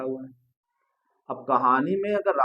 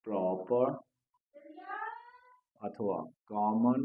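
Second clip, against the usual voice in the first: very slow, word by word with long pauses; stretched words, spaced far apart; slow dictation; 6 dB quieter, much slower than usual. Real, not acted. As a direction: dictate while writing it out.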